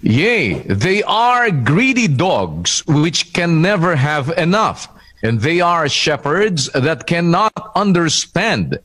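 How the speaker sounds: normal talking voice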